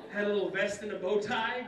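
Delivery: ordinary talk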